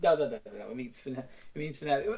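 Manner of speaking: normal talking voice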